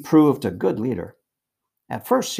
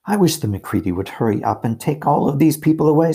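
put-on louder, high-pitched grumbling girl; exasperated complaint; 5 semitones higher, 6 dB louder than usual. A narrator's reading. Susan, cramped among coats, complains.

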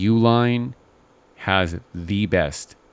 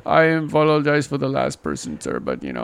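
mock Indian agent apology; 7 semitones higher than usual. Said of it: "an Indian voice".

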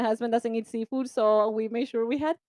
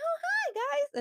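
much higher, bright greeting voice; high English voice; 5 dB quieter, slower than usual. Shown as a demonstration. Host showing her high English greeting. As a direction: pitch way up like in English. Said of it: "my voice just goes high".

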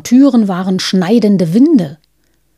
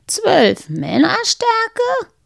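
much higher, slow disbelieving echo; incredulous echo; 12 semitones higher, much slower than usual. Acted. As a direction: repeat it in amazed doubt. Wise woman echoes in disbelief.

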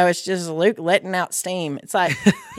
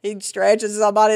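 usual speaking voice

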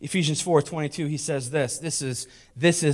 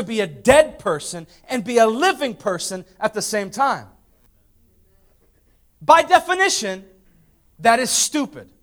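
high-pitched, forceful pulpit rebuke; full-force preaching; 9 semitones higher, 6 dB louder than usual. Real, not acted. Preacher rebuking those living between alive and dead, full-force preaching. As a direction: drive it home with raised pitch.

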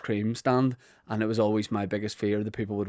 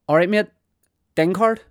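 mock local hard man, higher and louder; hard lad customer; 8 semitones higher, 5 dB louder than usual. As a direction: bark it like a tough guy at the counter. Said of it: "people used to come in and go".

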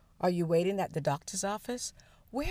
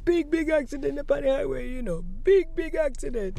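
high, loud imitation of a Jamaican man; Jamaican accent; 8 semitones higher, 6 dB louder than usual. Replaying the man's highway accident report, imitated Jamaican accent. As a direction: exclaim in mock Jamaican patois. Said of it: "this Jamaican accented gentleman speaking".

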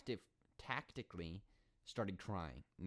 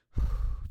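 heavy sigh; 14 dB louder than usual. Real, not acted. Exasperated about the bench.

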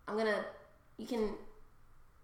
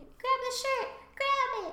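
high-pitched excited yell; mock frantic shout; 11 semitones higher, 4 dB louder, slower than usual. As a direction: play an urgent cry to the crowd. Acting out a t-shirt cannon.

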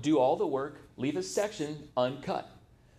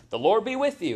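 Boaz greeting; 8 semitones higher, 4 dB louder than usual. Retold or imitated. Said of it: "and he says".

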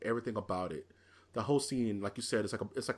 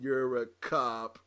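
robotic cop; much slower than usual. Retold or imitated. Robot cop's cold answer.

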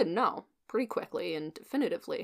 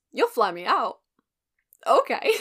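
surprised; 4 semitones higher, 8 dB louder than usual. Retold or imitated. Incredulous at free flight offer.

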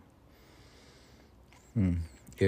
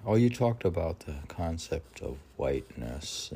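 normal talking voice

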